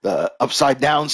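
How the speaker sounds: ordinary talk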